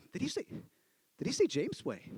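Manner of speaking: soft, halting question; hushed puzzlement. Retold or imitated. Someone up there checking what he said, hushed. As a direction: ask it under your breath, unsure. Stunned audience member murmurs question.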